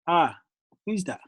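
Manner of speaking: usual speaking voice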